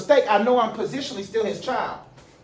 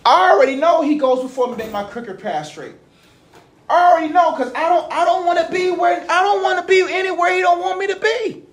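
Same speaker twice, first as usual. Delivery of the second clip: preaching, building higher and louder; climbing sermon voice, higher and louder; rising preaching fervor; 10 semitones higher, 3 dB louder than usual. Real, not acted. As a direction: lift it up like a pulpit climax. Preaching at full force.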